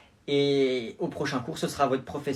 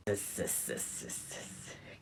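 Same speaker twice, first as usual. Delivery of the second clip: c'est répété dans un souffle, qui s'éteint; chuchotement étrange; 16 dB quieter, much slower than usual. Acted. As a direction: murmure inquiétant qui s'efface. (élève bizarre qui chuchote en boucle)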